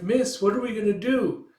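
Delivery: put-on high, young-sounding plea; worried little fox. Little fox asking Micah what comes next, worried and high.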